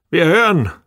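lightly sonorous, expectant summons. A narrator's reading. Müller calls from the door, sonorous voice. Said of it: "ertönte Müllers sonore Stimme von der Tür her".

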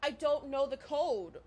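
worked-up, stammering exasperation; exasperated. Real, not acted. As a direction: push back at being asked what you just answered. Annoyed at being asked for code.

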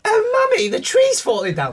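put-on very high, loud posh child crying for Mummy; posh boy impression. Imitating James as the tree falls, high posh squeal. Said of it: "That's my impression of James".

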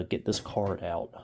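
ordinary talk

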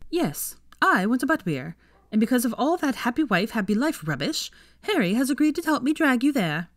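high-pitched, insistent friend; bossy Ginny. A narrator's reading. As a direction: overrule her with gleeful insistence.

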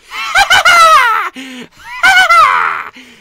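loud high maniacal cackle; evil cackle. Acted. Mad doctor cackling after introducing himself, loud and high.

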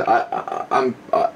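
ordinary talk, nothing marked

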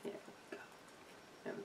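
breathy hushed whisper; barely-there soothing whisper. Real, not acted. Whispering to the possum as she eats.

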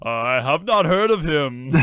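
big booming god voice, a touch puzzled; booming, incredulous. A narrator's reading. Voice from the storm doubting Judas, booming and incredulous. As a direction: boom it out with an air of incredulity. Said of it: "boomed back now with an almost an air of incredulity".

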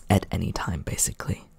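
breathy whisper, almost secret; hushed afterthought; whispered aside. Acted. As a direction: drop to a whisper for the last word. Intimate vampire roleplay aside.

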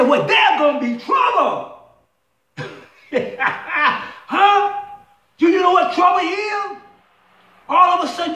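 high, loud, shouted sermon climax; full-force preaching. Acted. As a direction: drive the point home to the congregation with rising heat.

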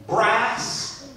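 ordinary talk, nothing marked

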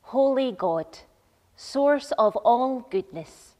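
usual speaking voice

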